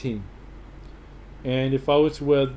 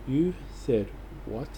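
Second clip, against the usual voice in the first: slow, softer incredulous challenge; mock disbelief; 6 dB quieter than usual. Fan answering a rival's Broncos remark, mock disbelief. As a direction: act stunned, spacing out the words. Acted comeback to rival fans.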